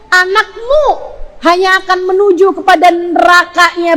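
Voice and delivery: high, loud, urgent warning; forceful preaching. Real, not acted. Preacher warning mothers of hell for their children, urgent forceful preaching. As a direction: drive each consequence home hard.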